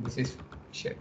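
muttered curse, barely voiced; whispered under breath. Real, not acted. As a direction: swear softly to yourself, almost silent. Frustrated aside under his breath.

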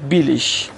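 بیلیش ending in "ish"; final G as SH. Shown as a demonstration. Teacher giving a second example, بیلیش ending in sh. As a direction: say بیلیش with sh for the written g.

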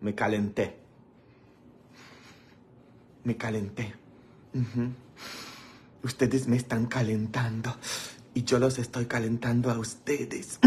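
soft, low-pitched murmur; teasing low aside. Pretend. Host telling viewers they warm him up, soft teasing aside. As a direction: drop to a quiet, low aside.

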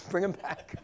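chuckling through the command; half-laughing as he plays God; a bemused God's order broken by laughter. Acted.

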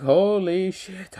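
drawn-out, high-pitched shock; acted astonishment. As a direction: stretch it out high, as if stunned. Acts out Naruto's shocked reaction.

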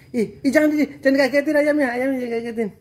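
high, quick alarmed don't-scare-the-chicken; urgent warning. Real, not acted. Dad urgently warning daughter not to startle the chickens, high and alarmed.

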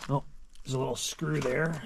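ordinary talk, nothing marked

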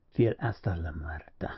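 low, whispered mutter; muttered through set teeth. A narrator's reading. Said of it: "muttered he between his hard-set teeth".

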